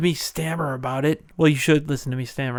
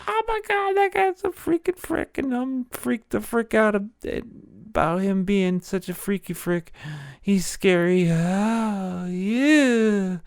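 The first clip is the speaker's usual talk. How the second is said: much higher, squeaky panicked gossip voice; freaked-out onlookers; 6 semitones higher than usual. Retold or imitated. [name] imitates horrified crowds, high and squeaky. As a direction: squeal like a terrified bystander. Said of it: "they'll be like".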